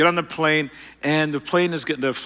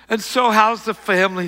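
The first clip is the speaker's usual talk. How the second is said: faked small talk; 6 semitones higher, 3 dB louder than usual. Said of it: "I'm gonna fake it".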